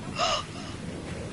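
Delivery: high, voiceless mock gasp; shocked gasp. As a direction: gasp as if scolded. Gasps at teacher's retold rebuke.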